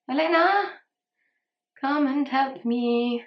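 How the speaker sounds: playful call for help. Real, not acted. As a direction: shout for Elena from another room. Calling someone for help.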